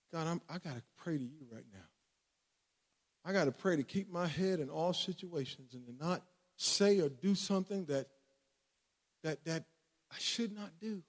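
very soft, low murmured prayer; retold hushed plea for self-control; private prayer. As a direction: pray under your breath, holding yourself back. Retelling his own private prayer.

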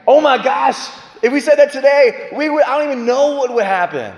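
excited disbelief. Real, not acted. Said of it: "exclaims at idea".

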